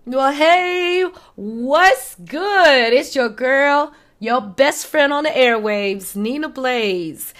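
loud, high radio-host greeting; big on-air hello to listeners; hyped show intro. Acted. Radio-style show opener greeting.

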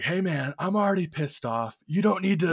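high-pitched imitation of an angry coworker; retold angry scream. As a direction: mimic a coworker snapping back, pitch climbing. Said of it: "he is screaming at me".

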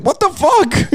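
a squeaky stunned what the fuck; mock disbelief, very high; shocked high squeak. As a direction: voice jumps way up in disbelief. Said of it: "I was like".

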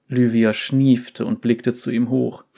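ordinary delivery, nothing marked

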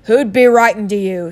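loud, scornful uncle; sneer. Acted. Uncle mocks the boy over his letter, sneering. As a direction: throw it out loudly with contempt. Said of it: "sneered Uncle Vernon".

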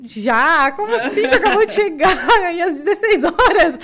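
high, loud, laughing disbelief; incredulous laughing yelp; shocked husband. Retold or imitated. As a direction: mimic his stunned outburst. Husband shocked she is already leaving.

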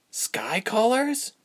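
high, slow, rising question; puzzled repeat. A narrator's reading. Ilse repeating the creature's term, puzzled. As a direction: echo the term, baffled.

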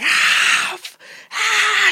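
high shrill mock scream of pain; imitated child's scream. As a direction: squeal it out like a kid in pain. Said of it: "she starts fucking crying and screaming".

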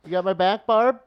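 loud playful call across the room. Real, not acted. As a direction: shout it out cheerfully to a coworker. Calling out to Barb.

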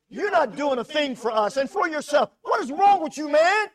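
loud, high angry shout. Acted. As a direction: yell at Jesus in bitter contempt. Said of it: "The first guy says".

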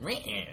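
short high "meh" noise; imitated game sound. Co-host recalling last week's sound, high imitated noise. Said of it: "No, it was like".

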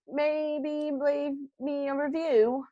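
high, drawn-out mock-British voice; British accent, pitched high and stretched out; bad British accent. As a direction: slip slowly into a badly faked British accent. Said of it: "went to a British accent there".

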